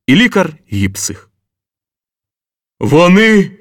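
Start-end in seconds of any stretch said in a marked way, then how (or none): 2.8-3.5 higher, louder cry from the door; exclaimed. A narrator's reading. General bursts into the office, shouting. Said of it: "вигукнув з порога Курвенко".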